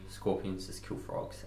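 slow it down and whisper it; hushed. Acted. Key line quoted, hushed.

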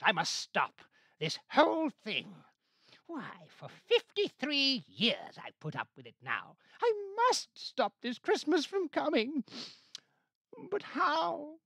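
high, loud frantic Grinch; Grinch rant. A narrator's reading. Grinch resolving to stop Christmas, high loud rant. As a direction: shriek it out determined.

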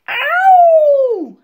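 long, high, loud wolf howl; drawn-out wolf yowl. A narrator's reading. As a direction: howl out in pain. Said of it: "howled the, uh, wolf".

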